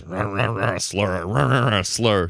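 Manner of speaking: low mumbled rah rah slur. Retold or imitated. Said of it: "he's just like".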